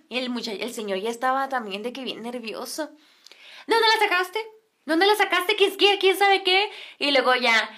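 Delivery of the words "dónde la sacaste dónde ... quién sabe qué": high-pitched nagging demand; mock an outraged woman interrogating; hysterical wife. Señora demanding where he got the girl, imitated hysteria. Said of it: "se empezaba a poner más histérica la señora".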